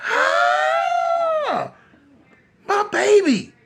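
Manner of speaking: long, very high horrified scream; mother's scream. Acted. Mama's friend seeing her son shot, high anguished scream. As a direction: scream it out high in acted horror. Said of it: "she put her hand over her mouth and she screamed".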